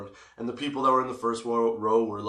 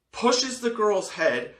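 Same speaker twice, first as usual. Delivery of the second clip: ordinary talk, nothing marked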